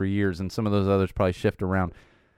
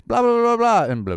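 loud, higher babbling imitation of a hyper kid; rapid babble; 12 semitones higher, 6 dB louder than usual. Dad mimicking his sugar-high daughter, loud rapid babble. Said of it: "we go from rapid speaking".

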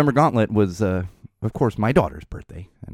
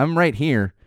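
normal talking voice

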